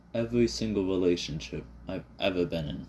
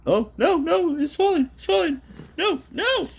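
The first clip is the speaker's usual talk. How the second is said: panicked alarm; 6 dB louder than usual. Real, not acted. Phone prop falling over.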